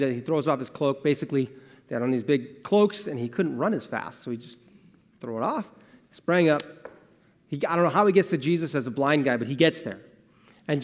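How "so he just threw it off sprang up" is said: usual speaking voice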